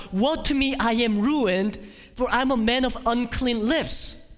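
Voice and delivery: high, loud prophet's cry of despair; anguished cry. Acted. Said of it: "Isaiah cries out".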